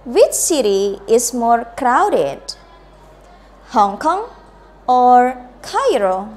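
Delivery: slow model, "Hong Kong" rising, "Cairo" falling; choice-question intonation. Shown as a demonstration. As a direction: lift "Hong Kong", stretch a loud "or", drop on "Cairo". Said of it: "Listen to me and then you can repeat".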